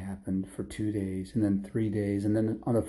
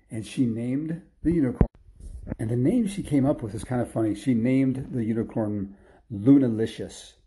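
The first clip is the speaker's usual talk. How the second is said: ordinary delivery, nothing marked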